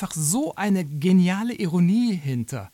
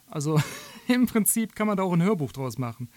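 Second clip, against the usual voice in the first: normal talking voice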